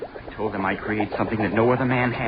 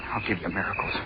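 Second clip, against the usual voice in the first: whisper; 7 semitones lower, 7 dB quieter than usual. Acted. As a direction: whisper it while creeping in. Sneaking into the lab at night.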